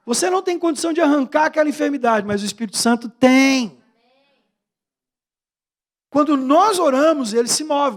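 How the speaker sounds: rhythmic call to faith with hammered refrains; forceful preaching. Real, not acted. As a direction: build in waves, each answer punched loud.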